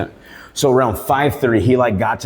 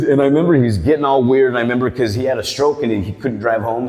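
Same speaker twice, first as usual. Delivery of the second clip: ordinary talk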